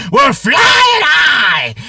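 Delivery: very slow, loud and high, stretched out; playful shout. Real, not acted. Opening his story with a loud, drawn-out hammy cheer. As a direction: belt it like a triumphant cheer. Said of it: "we're going out on a high note".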